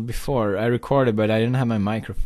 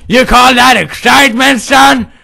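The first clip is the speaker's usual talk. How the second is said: loud, raised-pitch mock outrage; scolding father; 12 semitones higher, 11 dB louder than usual. Father unimpressed by son's cheer, shouting indignantly. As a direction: berate your son at full volume.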